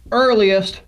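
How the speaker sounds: "earliest" set apart by pauses and firmly emphasized; "earliest" said on its own with weight; stress on "earliest". Real, not acted. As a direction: land deliberately on "earliest" as the key word. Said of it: "I emphasize that word".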